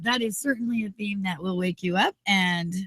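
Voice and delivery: tinny, muffled mic sound; tin can sound. Real, not acted. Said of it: "I sound like I'm in a tin can today".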